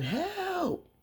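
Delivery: high, drawn-out cry for help; mock yell. Mama Rose yells amid knocked-over flowers, high mock yell. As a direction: imitate a yell without much volume. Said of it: "Mama Rose start yelling".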